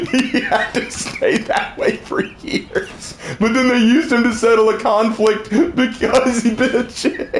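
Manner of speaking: real helpless high-pitched laughter through the words; laughing hard. Real, not acted. Streamer retelling Hera myth, cracking up laughing. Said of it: "That still makes me laugh".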